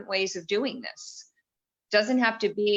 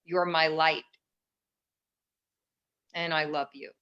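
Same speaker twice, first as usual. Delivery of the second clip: tender mantra; 2 semitones lower than usual. Shown as a demonstration. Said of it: "if we can turn and say".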